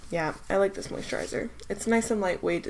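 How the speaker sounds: ordinary delivery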